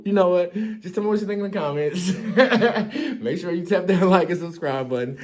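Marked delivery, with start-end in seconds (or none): none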